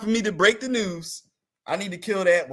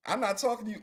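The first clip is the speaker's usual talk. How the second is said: ordinary delivery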